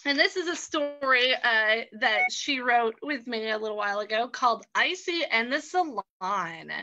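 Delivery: usual speaking voice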